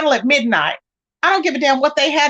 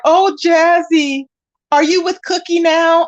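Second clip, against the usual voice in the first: drawn-out, higher mock whine; whining; 4 semitones higher, 3 dB louder, slower than usual. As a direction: whine it slowly, mocking his complaint. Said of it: "He was over there whining".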